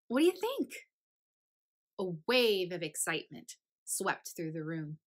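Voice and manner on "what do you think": eager question. Acted. Virginia asks eagerly.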